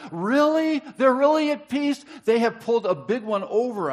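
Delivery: very high, loud incredulous really; mock disbelief. Real, not acted. Preacher mocking the Shechemites' claim with loud disbelief.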